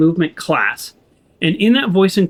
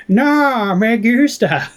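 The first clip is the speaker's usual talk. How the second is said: high, drawn-out mock Australian attempt at Spanish; Australian-accented Spanish; 5 semitones higher, much slower than usual. Imitating someone attempting Spanish for laughs, mock Australian accent. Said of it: "someone trying to do something with an Australian accent".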